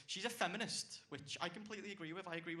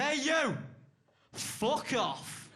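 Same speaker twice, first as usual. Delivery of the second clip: sudden furious shout, much higher and louder; angry yell; 8 semitones higher, 9 dB louder, much slower than usual. Retold or imitated. Homeless man yelling at the comic to fuck off. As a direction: bark it at the stranger who startled you. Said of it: "he went".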